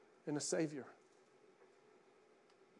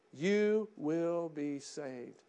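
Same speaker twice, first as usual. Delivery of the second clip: normal talking voice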